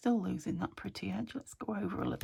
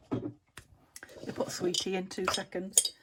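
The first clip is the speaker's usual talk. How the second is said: normal talking voice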